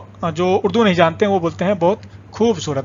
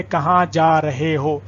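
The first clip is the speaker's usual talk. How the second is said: کہاں جا رہے ہو said slowly, every syllable clear; slow, clear teacher demo; slow example; slower than usual. Shown as a demonstration. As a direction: spell the example out for learners. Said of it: "جیسے آپ بولیں گے".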